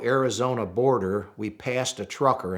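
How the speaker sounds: ordinary talk, nothing marked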